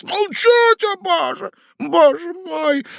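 high strained outburst; angry. A narrator's reading. Ivan Andreevich shouts him down in despair. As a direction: snap and then despair.

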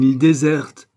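loud, emphatic retort of a queen; forceful acted outcry; exclaimed protest. A narrator's reading. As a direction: cry it out as an accusation. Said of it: "s'écria la reine".

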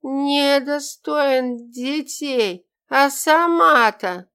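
put-on slow, high drunken shout; drunken outburst. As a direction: blurt it out loud and drunk. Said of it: "Последний выкрик получился чётким и громким". (громкий пьяный выкрик)

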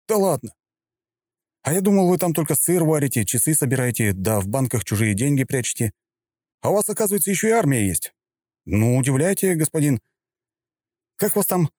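high, teasing and sarcastic; mocking hero voice. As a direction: needle him with fake amazement. Hero mocks Swiss general on phone.

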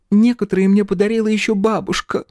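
high-pitched tearful whimper; acted girlish sobbing. A narrator's reading. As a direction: wail it as a crying girl would. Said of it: "всхлипнула Птраси".

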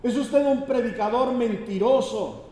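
high, loud, enraged yelling; hammed-up angry heckler yelling liar; furious shout. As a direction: bellow it at the preacher like he lied to you. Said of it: "y le gritó al predicador".

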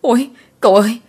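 high, loud frightened cry; panicked yell. A narrator's reading. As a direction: shriek it out in terror at the corpse. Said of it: "la toáng cả lên".

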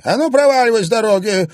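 high, loud and fast crone; angry old crone. A narrator's reading. Old woman drives the journalist away, high, loud and fast. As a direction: shoo him off the road.

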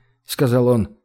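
usual speaking voice

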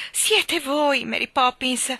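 usual speaking voice

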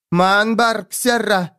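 high, slow, insistent command. A narrator's reading. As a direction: raise your voice, drawing out each word. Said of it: "повысила голос она".

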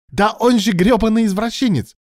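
very high, loud furious outburst; barked in anger. A narrator's reading. Alan condemns his rival to Ilya, barking furiously. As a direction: shout the insult at full heat. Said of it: "рявкнул Алан".

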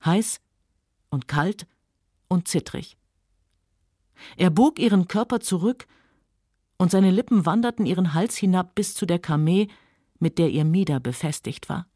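usual speaking voice

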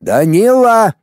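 high, forceful name call; outraged, drawn-out shout of the name; indignant. A narrator's reading. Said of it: "возмущённо добавил Пуговкин".

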